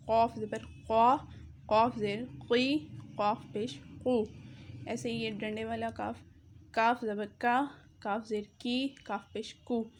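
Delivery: qaf heavy, kaf light; thick qaf versus kaf. Shown as a demonstration. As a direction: demo the two k sounds. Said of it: "قاف آپ کو موٹا پڑھنا ہے".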